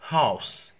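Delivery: "House" with a clear h, loud; H spoken in "House"; pronounced H. Shown as a demonstration. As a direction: push air on the h of "House". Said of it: "هنا راح تكون house".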